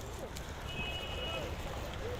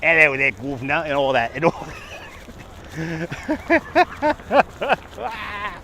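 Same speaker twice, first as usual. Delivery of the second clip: casual imitation Cockney accent; put-on Cockney accent; 5 dB quieter, much slower than usual. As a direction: play at being a Cockney. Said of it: "talking Cockney here".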